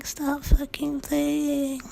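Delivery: like a trained cat forced to talk; halting, weirdly toned voice. Retold or imitated. The creature outside the door, stop fucking thing in a high halting inhuman voice. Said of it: "It says in this halting, weirdly toned voice".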